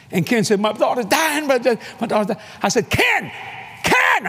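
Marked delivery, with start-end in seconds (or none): 0.6-2.4 high, frantic repeated plea; acted panic, words tumbling over; panicked father, retold. 2.9-4.3 high-pitched holler of a name; re-enacted urgent yell. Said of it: "I had to holler at him".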